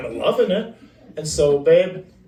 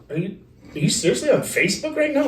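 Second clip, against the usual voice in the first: incredulous outburst; 8 semitones higher, faster than usual. Retold or imitated. Retold own outrage during argument.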